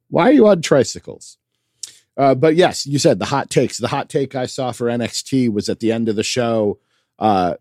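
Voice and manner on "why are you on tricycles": baffled. Real, not acted. Baffled by silly entrance.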